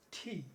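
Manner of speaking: ঠি with a puff after t; aspirated T. Shown as a demonstration. Teacher giving the correct T to pupils, aspirated. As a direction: release ঠি on a burst of breath. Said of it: "এটার উচ্চারণ কি হবে?".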